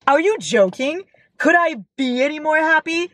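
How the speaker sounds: high, gushing rhetorical questions; delighted disbelief. Real, not acted. Delighted by the epilogue.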